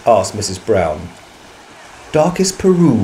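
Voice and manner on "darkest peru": ordinary delivery, nothing marked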